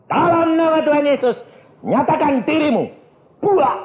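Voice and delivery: high, ringing order to a demon; forceful exorcism command. Retold or imitated. Ordering the devil to reveal itself, forceful command. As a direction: command it with full authority, high.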